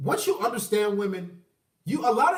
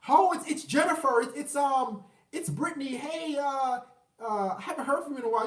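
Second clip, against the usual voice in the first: lightly voiced hesitant ex, pitched up; halting, higher-pitched mock casual hello; imitated returning ex; 5 semitones higher than usual. Ex contacting a man years later, imitated awkward hello. Acting out ex getting back in touch.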